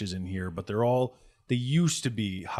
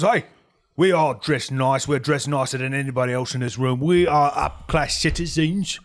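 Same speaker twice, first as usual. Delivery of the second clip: offended gang boss; 5 semitones higher, 8 dB louder than usual. Acted. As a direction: defend your elegance with wounded pride. Boss defends his clothing.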